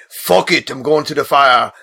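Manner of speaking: hammed-up amateur accent impression; the Hound's accent. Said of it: "Sorry about that accent too".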